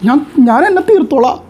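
high-pitched threat of a fed-up wife; a wife shouting at her husband, pitched high and desperate; despairing wife's threat. Retold or imitated. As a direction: throw out the threat to end it all, pitched high. Wife threatening suicide in quarrel.

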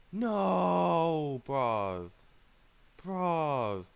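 long loud cry, falling away; dismayed wail. Real, not acted. Player protesting a loss, dismayed. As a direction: wail it high, then sink in despair. Wailing after losing.

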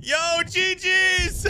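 excited shout. Real, not acted. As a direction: cheer out the good game. Celebrating after the fight.

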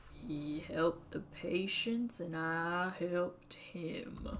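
put-on old Southern pharmacist voice; southern accent. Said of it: "when I do a southern accent".

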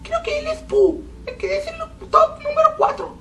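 strange squeaky high-pitched voice, whiny and teary; odd high squeak while gaming; weird high voice. Real, not acted. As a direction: talk in a strained high whine. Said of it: "¿Por qué ando hablando así?".

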